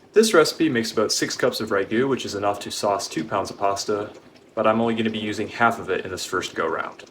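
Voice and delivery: usual speaking voice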